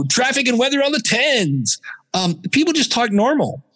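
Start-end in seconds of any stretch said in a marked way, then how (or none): 0.1-1.8 high, sing-out radio announcer. Acted. Host mocking traffic reports on old radio, DJ voice. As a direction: hype it like a cheesy jock. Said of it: "we weren't all talking like this".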